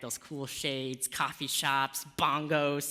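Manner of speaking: ordinary delivery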